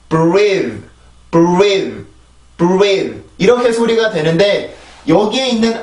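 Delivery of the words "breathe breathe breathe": "breathe" with lips pushed forward on the R, like "buweedh"; long stressed "breathe" with a w-like glide after B; rounded R onset. Shown as a demonstration. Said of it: "발음을 하다 보면 이렇게 소리가 됩니다".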